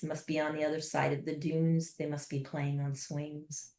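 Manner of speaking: ordinary talk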